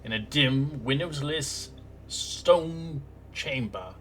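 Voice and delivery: storyteller painting a gloomy room; scene-setting narration. Acted. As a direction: describe the dungeon like a game master. Game master describes the scene.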